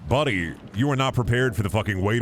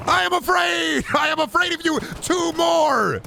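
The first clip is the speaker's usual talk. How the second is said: put-on shrieking kid panic; 3 dB louder than usual. Same shrieking voice, fear admitted.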